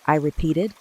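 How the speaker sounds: ordinary delivery, nothing marked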